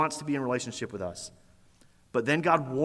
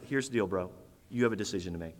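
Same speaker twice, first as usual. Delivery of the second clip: lowered and softer, patient and fatherly; gentle God voice; 8 semitones lower, 6 dB quieter, much faster than usual. Acted. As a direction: lay out the choice quietly, like a patient father. God voiced speaking to Cain.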